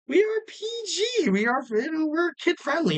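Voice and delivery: high-pitched, put-on innocence; playfully coy. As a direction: squeal it as a teasing disclaimer. Mock-prim claim of clean show.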